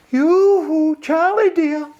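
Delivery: very high, sweet calling voice; tinkly pretty voice. Acted. Mermaid calling sweetly to Charlie from the weir. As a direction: call out like a coy little mermaid. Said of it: "kinda tinkly and clear, a pretty little voice".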